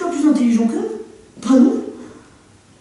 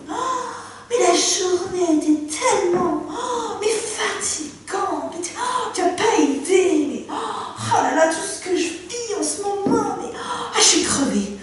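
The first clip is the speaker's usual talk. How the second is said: wife collapsing after work, sighing; exhausted sighs; 4 semitones higher, 7 dB quieter than usual. Acted. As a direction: sigh through every word. Wife comes home exhausted, sighing.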